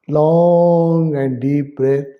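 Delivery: slow, sustained breathing cue; drawn-out guidance. Shown as a demonstration. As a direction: hold the vowel of "Long" very long. Models a long breath while guiding.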